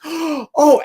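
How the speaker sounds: high, loud breathless oh; gasping fan. Retold or imitated. Starstruck woman meeting them, high breathless gasp. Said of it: "she goes".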